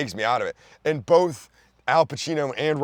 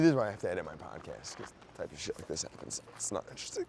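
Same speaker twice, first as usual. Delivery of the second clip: ordinary delivery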